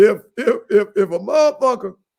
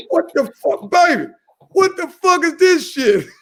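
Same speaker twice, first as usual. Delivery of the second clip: laughing mock outrage; 10 semitones higher, 3 dB louder than usual. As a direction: act out shock while cracking up. Retold finding dildo, laughing.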